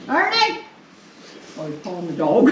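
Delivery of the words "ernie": a high, loud call across the house. Real, not acted. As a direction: call Ernie from the other room. Calling to husband about dog.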